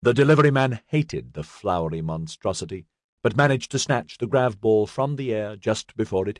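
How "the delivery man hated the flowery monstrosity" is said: ordinary delivery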